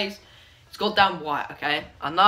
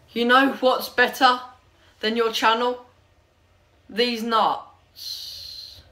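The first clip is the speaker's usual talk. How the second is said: comment read-out; 4 semitones higher, much slower than usual. Acted. Vlogger reads a mean comment to viewers, declaiming it. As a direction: announce it in chunks, building to the punchline.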